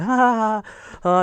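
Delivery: high, loud imitated laughing; exaggerated retold ha-ha; mock laugh. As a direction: laugh it off as the staff, loudly. Imitates staff laughing him off.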